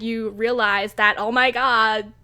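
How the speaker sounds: ordinary talk, nothing marked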